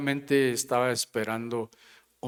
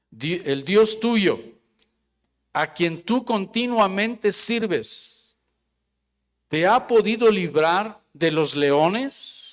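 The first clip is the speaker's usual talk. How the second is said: measured Bible reading, the king's words slowed; scripture reading; 5 semitones higher, 4 dB louder, slower than usual. Acted. Pastor reading the lions' den verses, measured and slow. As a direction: read the verse with weight. Reading resumes after remark.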